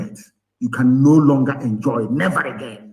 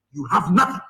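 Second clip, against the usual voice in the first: ordinary talk, nothing marked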